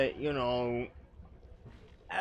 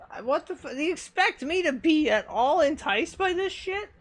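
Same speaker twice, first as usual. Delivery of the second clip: outraged rant; 11 semitones higher, 4 dB louder than usual. Real, not acted. Outraged at soda advertising.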